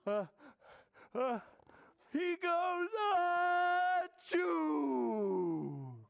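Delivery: high gasping build-up into loud sneeze; huge bear sneeze. Acted. Storyteller as bear with pepper, huge mock sneeze. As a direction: wind up in pieces, then burst into a long achoo. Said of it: "he blows and he sneezes".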